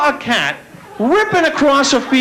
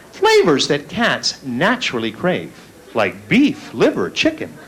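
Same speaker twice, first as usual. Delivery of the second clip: mock commercial voice; 7 semitones lower, 3 dB quieter than usual. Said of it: "There's one commercial drives me nuts".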